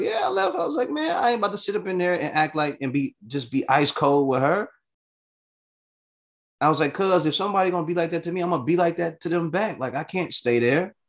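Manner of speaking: high, fast, worked-up protest; worked-up insistence. Retold or imitated. Telling his cousin on the phone he had to leave, high worked-up protest. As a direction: justify leaving with heat.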